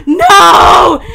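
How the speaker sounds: high, loud scream of fright; sudden panicked yell at a scare; terrified shout. Real, not acted. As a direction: shriek it in real terror. Jump scare in horror game.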